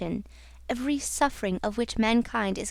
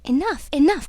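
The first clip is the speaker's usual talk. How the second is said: high, loud protest; desperate outburst from the young man; cried out; 5 semitones higher, 6 dB louder than usual. A narrator's reading. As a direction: cry out to stop her. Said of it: "cried Raoul".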